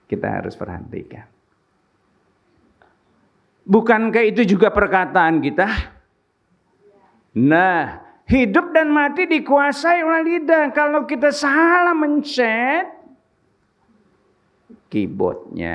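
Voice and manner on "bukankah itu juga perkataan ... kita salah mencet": fiery sermon voice; full-force preaching. Acted. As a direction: preach it out to the congregation.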